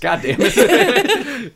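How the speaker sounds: usual speaking voice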